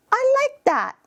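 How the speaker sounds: put-on very high, squeaky child voice; high-pitched kid enjoying a toy; little child. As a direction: pipe up like a small kid. Child responding to toy in role-play.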